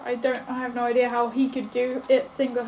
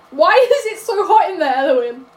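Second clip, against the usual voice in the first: loud, higher-pitched exasperated question; loud complaint; 5 semitones higher, 10 dB louder than usual. Acted. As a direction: call it out in protest. Character complains of sudden heat.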